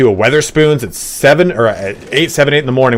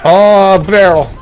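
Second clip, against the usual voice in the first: surprised; 10 semitones higher, 6 dB louder, much slower than usual. Real, not acted. Spots a barrel in game.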